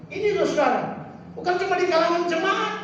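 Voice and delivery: high, urgent preacher's voice; fervent preaching. Acted. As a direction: warn the congregation with mounting force. Preaches with force. (khotbah berapi-api dengan nada tinggi)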